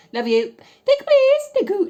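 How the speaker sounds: very high, loud, squealing thanks; giddy squeals. Real, not acted. After sending the voice note, squealing with glee. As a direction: shriek it out in giddy delight.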